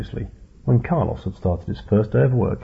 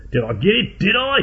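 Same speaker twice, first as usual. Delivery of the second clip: eager, rising to a much higher yelp; shouted; 11 semitones higher than usual. A narrator's reading. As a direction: bellow it hoping for a hit. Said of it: "he shouted".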